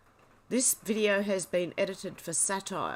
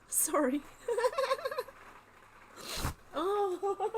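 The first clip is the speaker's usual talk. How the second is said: high, apologising through laughter; real giggles that won't stop; tearful laughter; 12 semitones higher, much slower than usual. Real, not acted. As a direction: try to recover from a laughing fit. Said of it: "tears to come out of my eyes".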